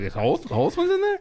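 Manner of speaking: surprised quote. Retold or imitated. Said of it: "I was like".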